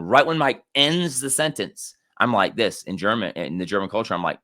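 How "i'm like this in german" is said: ordinary talk, nothing marked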